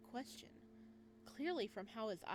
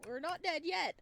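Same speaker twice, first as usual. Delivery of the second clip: excited outburst; 9 semitones higher, 11 dB louder than usual. Real, not acted. Player reacting to the game.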